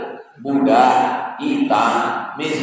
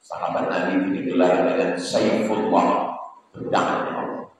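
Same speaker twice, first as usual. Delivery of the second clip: normal talking voice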